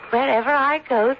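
ordinary talk

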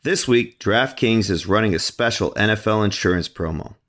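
loud, upbeat sponsor read. Acted. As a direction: pitch the promo like an announcer. Guest reads sponsor ad.

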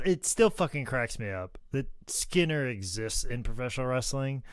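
ordinary talk

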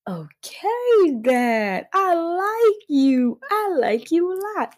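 high, drawn-out mock swoon; smitten gushing. Replying to someone proudly bi, high smitten gushing. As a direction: squeal it slowly, playing smitten.

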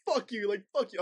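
high-pitched mock yell; acted-out defiant outburst, half-shouted; retold yelling. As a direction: hurl the curse in a high voice. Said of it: "screaming and yelling at it".

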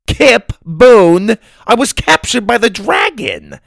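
high, loud indignant cry; exasperated outburst. A narrator's reading. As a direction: explode at your clueless husband. Grandma erupts at his accusation.

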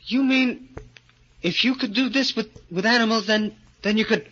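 high, eager, astonished small voice; hope rising in a tiny man's voice; breathless amazed little man. Acted. Little man hearing a cure exists, high and amazed. Gorgo hearing about growth serum.

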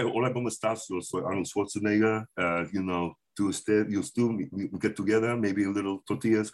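put-on low Austrian accent on Spanish; Arnold Schwarzenegger. Comic shows his new Arnold bit, low Austrian accent speaking Spanish. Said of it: "Arnold working on that guy".

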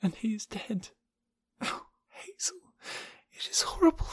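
high, breathy, anguished lament; grief near sobbing. A narrator's reading. Jane mourning his death at sea, anguished, near collapse. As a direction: start very faint and breathy, swell into grief, break off. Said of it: "sank sobbing to the floor".

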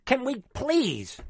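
loud, fervent rallying cry, pitched high; real excitement bursting out in a plea; getting excited. Real, not acted. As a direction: get carried away urging everyone to join. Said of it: "I'm getting excited".

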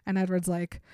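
ordinary delivery

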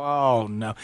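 low, soft sinking "oh no"; quiet dread. Retold or imitated. Dreading his wife's phone argument.

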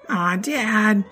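put-on high childish whine; little boy sulking; whiny kid voice.

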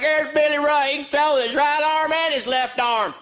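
high, slow bragging dad voice; hammed-up proud Texas father. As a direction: boast about your boy at the ballgame. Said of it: "He's like".

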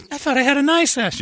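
very high hurt whine; hurt woman voice. Acted. Voicing the woman's reply.

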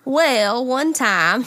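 mock Southern drawl; down south accent. Co-host guessing how Minnesotans talk, in a Southern accent. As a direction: put on down south country talk. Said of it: "that's like down south talk like that".

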